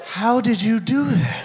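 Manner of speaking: high, slow, squeaky girl impression; high mock girl voice. Acted quote of hickey girl.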